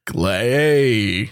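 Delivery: low, drawn-out imitation of the caller; deep Clay. Friends imitating how the caller says his name, low. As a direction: say the name low and slow like him. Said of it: "He'd be like".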